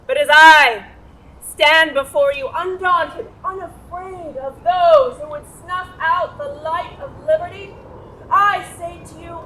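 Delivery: mother acting out the rally speech; rousing oratory. Shown as a demonstration. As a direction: whip the crowd to a sworn oath.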